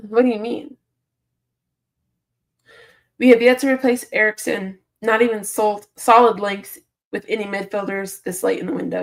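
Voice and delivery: ordinary talk, nothing marked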